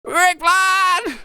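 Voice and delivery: high yell across the deck; calling out. Acted. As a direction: shout his name while running. Crumb runs waving, calling.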